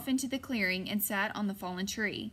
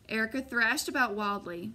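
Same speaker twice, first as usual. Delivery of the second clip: ordinary delivery, nothing marked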